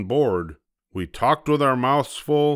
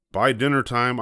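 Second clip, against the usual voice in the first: plain reading voice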